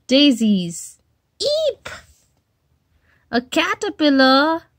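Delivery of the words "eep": tiny shrill eep; high startled peep; very high squeak. Acted. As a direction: squeak in alarm. Itch reaction.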